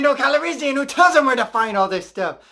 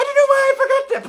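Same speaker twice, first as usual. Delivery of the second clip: high, fast, laughing through words; 4 dB louder, faster than usual. Real, not acted. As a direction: giggle it out fast. Laughs at own mistake.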